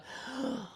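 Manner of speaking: quick startled intake of breath; sharp gasp. Real, not acted. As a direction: catch your breath at a sudden feeling. Sudden feeling of sadness arriving.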